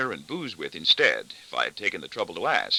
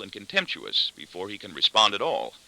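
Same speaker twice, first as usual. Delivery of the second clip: ordinary delivery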